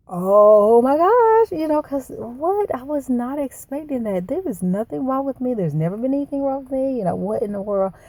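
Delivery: stunned, high-pitched disbelief, reliving the news; retold shock and bewilderment. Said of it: "I was in shock".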